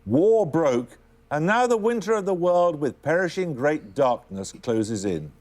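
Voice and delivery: ordinary talk, nothing marked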